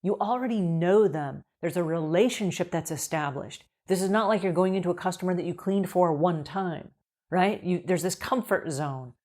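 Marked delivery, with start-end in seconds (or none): none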